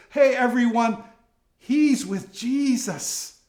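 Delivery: public outing. Retold or imitated. Servant girl outing Peter in front of everyone, high loud call. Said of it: "outs him in public in front of everyone".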